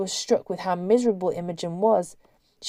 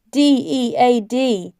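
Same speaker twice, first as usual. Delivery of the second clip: slow, higher, laboured letters; deliberate, drawn-out spelling; effortful spelling; 4 semitones higher, 4 dB louder, slower than usual. A narrator's reading. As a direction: push out each letter slowly. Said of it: "spelled Sally with great effort".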